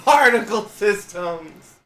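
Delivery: slow, high, drawn-out words. Pretend. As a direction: stretch each syllable out with a laugh. Repeated drawn out.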